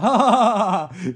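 loud hammed-up ha ha ha; mock laugh. Characters mocking the sequel with a loud fake laugh.

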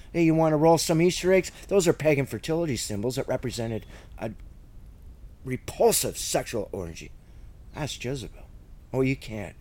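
normal talking voice

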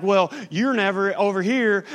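loud and fast, finger-pointing gripe; complaining spouse. Retold or imitated. Pastor imitating a spouse blaming the other in counseling, loud fast gripe. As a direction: rattle off the complaint like an accusing spouse.

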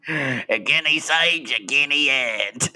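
slow, drawn-out broad Cockney; Cockney chimney sweep. Pretend. Sweep crying prices to passers-by, slow broad Cockney. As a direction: stretch the vowels in hammed-up Cockney. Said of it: "Cockney eighteen eighties chimney sweep".

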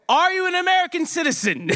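high, re-enacted yelled question; shouted demand. Shouts questions through the door.

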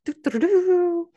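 loud, high sung trumpet flourish; drawn-out vocal ta-da, belted slowly; mock fanfare. Author announcing a new release, playful mock fanfare.

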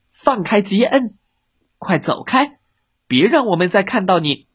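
high, commanding order to leave; loud command. A narrator's reading. Billy loudly ordering the fox to let the worm go. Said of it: "比利大声命令".

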